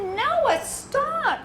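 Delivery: high-pitched motherly telling-off; acted mom losing patience; exasperated scolding. Mother scolds son upsetting sister.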